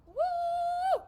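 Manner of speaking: a very high, loud whoop of celebration; cheer. Real, not acted. Coach cheers the class for finishing the plank, loud high whoop. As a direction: whoop like the crowd's going wild.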